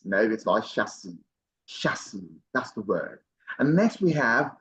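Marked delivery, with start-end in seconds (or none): 0.7-2.3 "chassis" said "SHA-see", no s at the end, much softer; correct chassis, softly. Shown as a demonstration. Teacher corrects the common mistake in a soft voice. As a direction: drop the final s in "chassis". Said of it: "people sometimes say".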